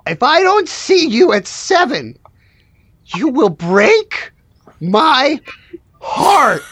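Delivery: slow, word-by-word wounded threat; hammed-up heartbreak with weighty pauses; melodramatic warning. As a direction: spell out the ultimatum dramatically. Needy worker repeats heartbreak warning.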